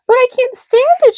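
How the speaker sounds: high, loud desperate shout; high loud pig shout. A narrator's reading. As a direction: shout it out in despair. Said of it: "shouted Wilbur".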